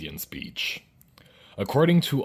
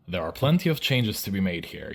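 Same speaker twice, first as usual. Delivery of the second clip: ordinary delivery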